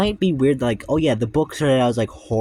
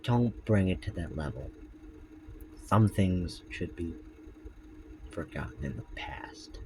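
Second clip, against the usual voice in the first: fading to a whisper; 5 semitones lower, 9 dB quieter than usual. Acted. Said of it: "Freddy pleading, fading".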